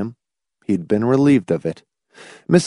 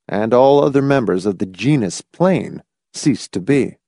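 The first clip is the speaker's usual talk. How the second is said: usual speaking voice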